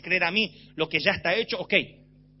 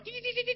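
"ti, ti" muy agudo y suave; sonido de láser imitado; 14 semitones higher, 5 dB quieter than usual. Retold or imitated. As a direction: imita un juguete de luces. Imita lucecita de juguete. ("ti, ti, ti, ti" agudo y suave, como láser de juguete)

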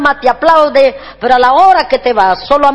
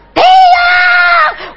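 put-on child yelling aunt. Nephew calling the aunt, a squealing child's yell. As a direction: shriek like a needy little kid.

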